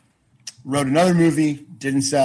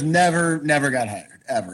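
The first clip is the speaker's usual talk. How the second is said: ordinary delivery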